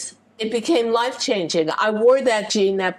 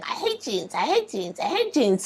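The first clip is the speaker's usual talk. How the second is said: a touch of mock tearful whining, repeated; imitating crying; 4 semitones higher, 3 dB quieter than usual. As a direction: mimic a host near tears, half-hearted. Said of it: "she's crying and saying".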